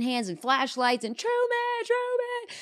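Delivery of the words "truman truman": calling out a name. Retold or imitated. Townspeople searching, calling out for Truman. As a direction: call across the town for him.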